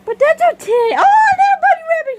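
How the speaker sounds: high, loud, fast shout; excited shout. Real, not acted. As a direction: burst out squealing at the bunny. Shouting cheerfully at pet.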